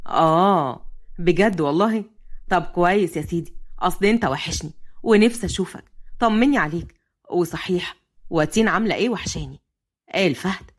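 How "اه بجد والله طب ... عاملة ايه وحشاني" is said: low-pitched male character voice; Qasim, affectionate friend. Acted. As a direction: ask after them fondly in a deep voice. (بصوت قاسم الواطي الودود)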